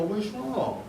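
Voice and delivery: soft, hushed and high, asking what's wrong; gentle concern. Retold or imitated. Asking his crying wife what's wrong, soft and hushed. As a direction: coax it out of her softly, worried.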